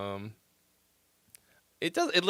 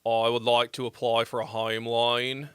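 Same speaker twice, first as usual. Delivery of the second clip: lightly put-on Australian accent, lower; a casual mock Australian accent; 6 semitones lower than usual. Streamer imitating an Australian guest, a light Australian accent. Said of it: "'Cause they're, uh, Australian".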